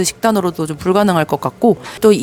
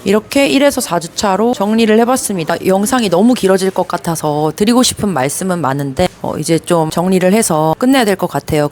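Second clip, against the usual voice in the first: normal talking voice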